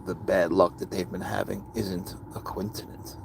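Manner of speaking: hushed, ominous whisper; menacing whisper. Real, not acted. Warning his online critics in a hushed, menacing whisper. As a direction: whisper it like a quiet threat.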